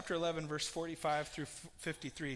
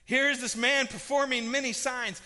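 high, loud frustrated outburst; exasperated council elder; 5 semitones higher, 7 dB louder than usual. Acted. Council elder venting at the others, loud and frustrated. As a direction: pound the table and demand answers. Sanhedrin council arguing heatedly.

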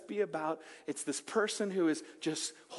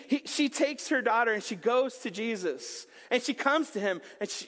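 normal talking voice

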